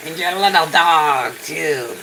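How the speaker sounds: lightly voiced rough witch, barely shifted; rough witch impression. Streamer tries a rougher version of the witch quote, only lightly voiced. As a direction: add grit to the witch line. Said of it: "Gotta put some roughness in with the squeakiness".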